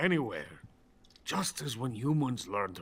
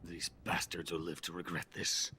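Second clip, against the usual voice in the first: muttered grudge; 4 semitones lower, 6 dB quieter than usual. Acted. Scientist curses his betrayers.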